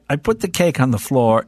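usual speaking voice

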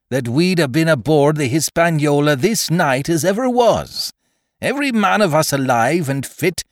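Silver. Acted. Captain answering the crew's charges point by point, indignant. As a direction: argue back forcefully, with dips into dry scorn.

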